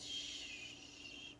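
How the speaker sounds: soft breathy shh of wind; gentle whispered wind; wind shush. Retold or imitated. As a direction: let it out like wind in leaves.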